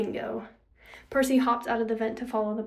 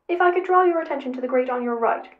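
louder, formal robot guide; louder robot character voice; robot companion; 2 semitones higher, 8 dB louder, faster than usual. A narrator's reading. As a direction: announce it loudly like a helpful robot. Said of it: "in the robot's voice".

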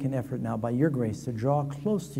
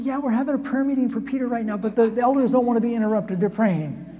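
put-on high, fast, louder girl's voice, hurried; Rhoda brushing him off; 10 semitones higher, 6 dB louder than usual. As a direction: shoo him away quickly, she's busy. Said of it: "She said".

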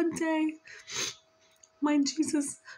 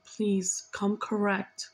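slow, low plea from a father; lightly voiced father, slowed right down; channeled father; 7 semitones lower, slower than usual. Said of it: "He's saying".

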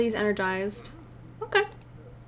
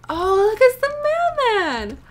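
excited; 10 semitones higher than usual. Real, not acted. Excited at a new Sim.

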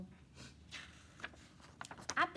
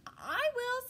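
high, loud eager dog voice; keen farm dog; 13 semitones higher, 6 dB louder than usual. Acted. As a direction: pipe up like a hungry dog.

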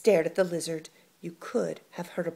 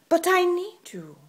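desperate plea; 13 semitones higher, 12 dB louder than usual. Acted. Lizard pleading desperately.

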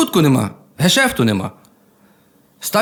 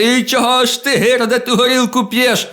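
higher-pitched mock scold, a bit slowed at the end; 8 semitones higher than usual. Wife scolding her drunk husband home from the tavern, mock high scold. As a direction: play the nagging wife, raised pitch not volume. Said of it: "і свариться".

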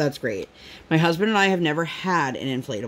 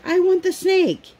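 put-on child voice; 13 semitones higher than usual. Child voiced in mom's story.

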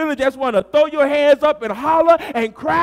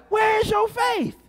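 preaching cry; 9 semitones higher than usual. Acted. Preacher's repeated challenge.

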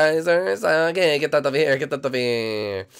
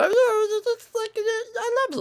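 slow, high and tender; high slow character voice; slower than usual. Acted. Yoshi telling Birdo he loves her, high and slow. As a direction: draw it out lovingly.

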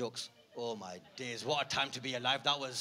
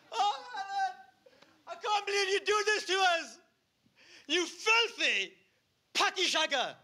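high, loud, laughing-through wail; very high loud hammed-up betrayed yelling; wailing accusation; 6 dB louder, slower than usual. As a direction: scream at the cheating partner. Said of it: "he's like".

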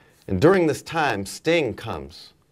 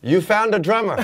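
high, loud call down the table; retold yell; 11 semitones higher, 5 dB louder than usual. Drummer butting in on [name]'s conversation, yelling down the table. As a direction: re-enact calling out to someone far off. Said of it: "So I yell down the table".

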